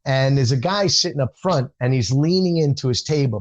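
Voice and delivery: normal talking voice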